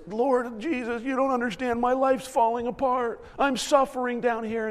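lightly voiced complaining child of God; a hint of a pouting plea; whining to the Lord like the upset daughter; mock whining believer. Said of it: "where we go to the Lord over this".

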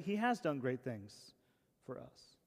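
slow, softening line with "has" stressed. Shown as a demonstration. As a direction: lean on "has", then let the rest drop away quietly. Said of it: "Emphasis mine".

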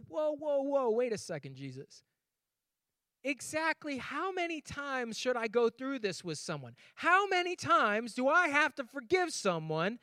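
high, hammed-up Peter pushing back; Peter protesting. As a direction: play Peter, half-whining about forgiving. Peter voiced in retold gospel.

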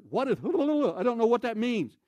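high, loud bewilderment; baffled question. Retold or imitated. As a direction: mimic the strange cry while asking.

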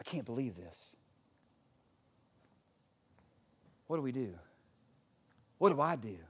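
low, soft, sinking with long pauses; imitated exhausted despair. Exhausted wife telling her husband what went wrong, imitated weariness. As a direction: list the troubles as if worn out. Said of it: "she just gets exhausted and she just goes".

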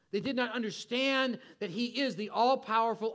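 ordinary talk

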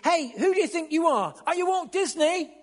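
loud, high-pitched shout of an indignant guard; shouted challenge. Retold or imitated. Security guard stopping a child star jumping the queue, shouting. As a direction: call out sharply, imitating an officious security guard. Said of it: "and shouted".

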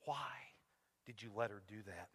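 very soft, low, broken into long pauses; hurt daughter's plea. Retold or imitated. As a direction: quote a betrayed little girl, hushed and heavy. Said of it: "I can still hear her voice".

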